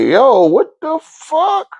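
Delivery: high, slow amazed exclamation; stunned disbelief. Retold or imitated. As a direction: stretch it out in pure astonishment. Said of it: "I was like".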